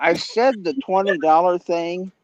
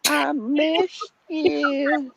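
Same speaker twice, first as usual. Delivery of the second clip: high slow voice; 9 semitones higher, much slower than usual. Pretend. Clip opening the stream.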